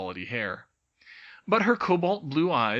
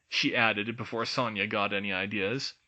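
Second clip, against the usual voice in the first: plain reading voice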